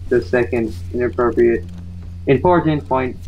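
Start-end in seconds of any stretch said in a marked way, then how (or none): none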